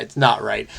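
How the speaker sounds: ordinary talk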